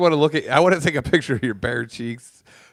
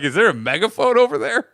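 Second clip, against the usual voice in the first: high, raised teasing imitation; mock loud talking; 11 semitones higher, 4 dB louder than usual. Teasing friend about his volume, mock loud. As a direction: tease him with an overloud voice. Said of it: "I was loud as fuck being like".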